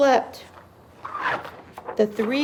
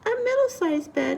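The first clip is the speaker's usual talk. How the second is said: middle-sized voice; 7 semitones higher, 4 dB quieter than usual. A narrator's reading. Voices the middle bed's size.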